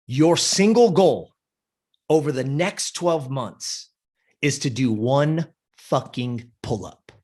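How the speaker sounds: slow, weighted retold pronouncement; solemn, deliberate. Coach setting the client's single goal, slow and deliberate. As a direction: lay down the condition word by word. Coach sets her one condition.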